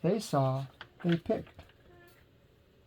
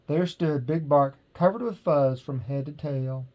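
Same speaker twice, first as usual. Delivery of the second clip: usual speaking voice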